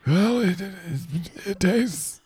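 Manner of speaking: high squeaky put-on voice for the friend; Brandon's voice. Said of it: "Let's ask Brandon".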